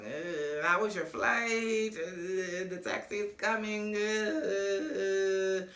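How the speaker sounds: slow, soft, stretched small talk; hammed-up awkward chitchat with long fillers; mock awkward. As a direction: drag out the uhs, imitating discomfort. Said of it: "awkward energy, awkward silence".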